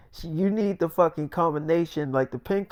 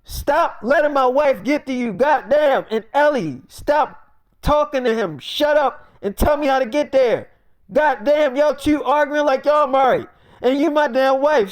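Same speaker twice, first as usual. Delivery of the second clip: raised, louder-pitched telling-off; retold fed-up outburst; exasperated scolding; 8 semitones higher, 4 dB louder than usual. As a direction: scold two bickering friends, voice up high. Acted quarrel, scolding friend and wife.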